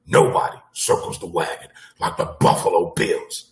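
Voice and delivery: low-pitched sportscaster catchphrase; deep announcer-style quote; Chris Berman. Retold or imitated. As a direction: lightly imitate a sportscaster's signature call, voice dropped. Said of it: "in the words of Chris Berman".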